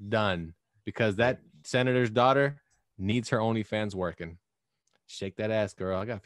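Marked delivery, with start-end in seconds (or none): none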